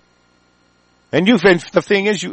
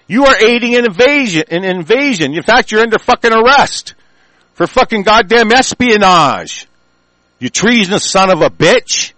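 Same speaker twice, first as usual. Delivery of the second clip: raised, loud, accusing tirade; angry accusation; 6 semitones higher, 3 dB louder than usual. Real, not acted. Host accusing the patrol chief of treason, angry shouting. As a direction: hurl the charges at him one after another.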